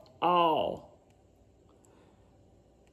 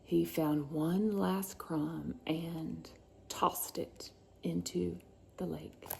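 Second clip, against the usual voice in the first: suspense whisper; 2 semitones lower, 10 dB quieter than usual. A narrator's reading.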